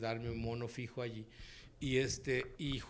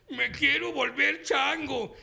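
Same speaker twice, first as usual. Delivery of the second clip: high, slow, loud cartoon wail; stretched, exaggerated dubbing cry; Homer's line; 13 semitones higher, 11 dB louder, much slower than usual. Shown as a demonstration. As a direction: belt it out as Homer in the booth. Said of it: "Entonces dije".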